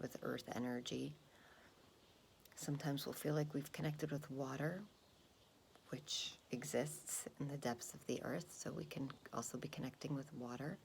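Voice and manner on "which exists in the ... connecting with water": calm grounding exercise. Acted. Meditation instruction.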